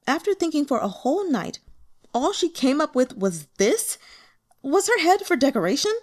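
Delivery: exasperated. A narrator's reading. Narrator's exasperated aside.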